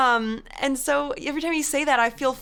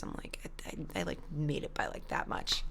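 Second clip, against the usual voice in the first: hushed aside; 7 semitones lower, 12 dB quieter than usual. Real, not acted. Barely scraped through college.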